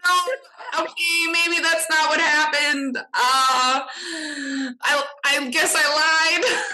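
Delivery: high-pitched mock panic, dragging to a sheepish finish; panicked backtracking. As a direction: backtrack in a squeaky, hammed-up fluster. Retold panicked backtracking to parents.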